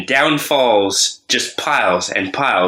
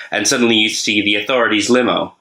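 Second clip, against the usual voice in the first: ordinary talk, nothing marked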